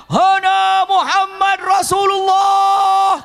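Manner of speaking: high, loud, very slow shout; booming shout. Retold or imitated. Abbas shouts across the battlefield, slow booming call. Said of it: "Abbas teriak".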